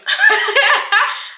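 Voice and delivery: big burst of laughter; loud high laugh. Real, not acted. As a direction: crack up loudly at your own joke. Bursts out laughing at own joke.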